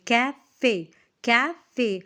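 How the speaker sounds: कैफ़े broken into two beats, कै - फ़े; syllable by syllable. Shown as a demonstration. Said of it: "तोड़ के उस word को आप प्रोनाउंस".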